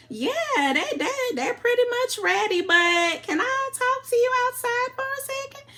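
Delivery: high, laughing Southern country twang. Retold or imitated. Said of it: "trying to get my country twang on".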